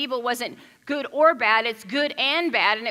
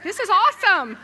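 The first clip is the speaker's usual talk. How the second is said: delighted; 10 semitones higher, 7 dB louder than usual. Retold or imitated. Retelling her delighted reaction.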